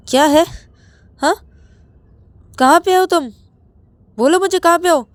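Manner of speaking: put-on husband screaming at his drunk partner; furious shout. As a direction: scream the question down the phone. Ravi demands where Rahul is.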